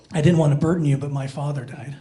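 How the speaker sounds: low, softening to very quiet; imitating gentle bad news, voice sinking away; subdued confession. Girlfriend telling him of her father's death, subdued. Bereaved girl breaks news by phone.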